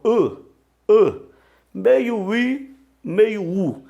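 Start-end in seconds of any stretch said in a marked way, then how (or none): 0.0-1.2 I said high and loud, with rounded lips between i and u; German ü sound. Shown as a demonstration. Teacher showing students the Greek upsilon sound, high and loud. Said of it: "É o som do I alemão".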